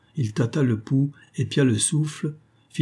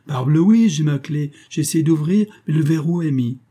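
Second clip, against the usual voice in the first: agitated, higher and louder; worked-up reply, pitched up with a forceful start; exasperated; 5 semitones higher, 4 dB louder than usual. A narrator's reading. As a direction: protest in exasperation. Locked-out painter, exasperated, answers his friend.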